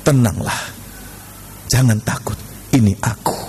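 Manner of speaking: low, measured divine comfort; calm reassurance. Acted. The Lord to frightened disciples on the lake, calm reassurance.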